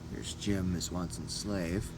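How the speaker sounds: ordinary delivery, nothing marked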